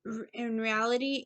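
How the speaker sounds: normal talking voice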